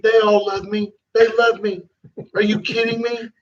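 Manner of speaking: Trump-style boastful asides, rambling and repeating; sales-pitch Trump impression with emphatic repeats; Trump impersonation. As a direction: do Trump tossing out self-satisfied asides. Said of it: "work Trump's little impersonation, you gotta learn to".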